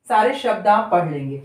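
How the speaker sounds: ordinary talk, nothing marked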